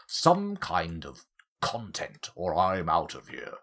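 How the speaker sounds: loud mock colonel complaining; pompous colonel. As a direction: bluster like a brandy-swilling colonel. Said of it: "'Cause you all talk like that".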